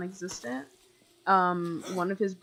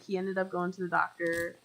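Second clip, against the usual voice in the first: ordinary delivery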